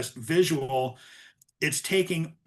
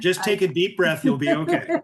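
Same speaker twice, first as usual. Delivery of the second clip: laughing through teasing reassurance; chuckling, voice jumping high at the end; 4 semitones higher, 5 dB louder than usual. Real, not acted. Joking reassurance amid shared laughter.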